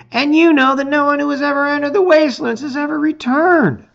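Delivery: put-on high, carefully reasoned young voice; Sars's voice. Sars warns Chark about the wastelands, high and earnest. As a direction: remind him like the cautious friend. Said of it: "Sars reminds him".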